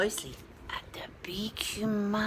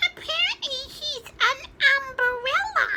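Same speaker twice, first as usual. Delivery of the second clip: peeping chick; 6 dB louder than usual. A narrator's reading. Smallest chicken agrees, high laughing peep. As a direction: chirp it like a tiny chick. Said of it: "peeped the smallest chicken".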